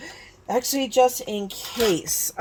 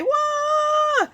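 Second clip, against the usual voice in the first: squeaky startled yelp; 4 dB louder than usual. Real, not acted. The die slips, a comic yelp.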